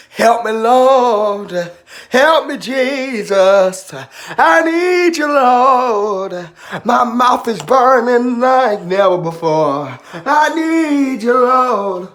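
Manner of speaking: slow, drawn-out high plea to the Lord; church-style wailing prayer. Pretend. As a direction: cry out like a preacher in full prayer. Minister wailing prayer over burning mouth.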